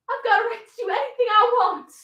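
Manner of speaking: high, indignant daughter talking back; defiant retort. Acted. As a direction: fire back at Daddy in protest. Daughter shouting back at father.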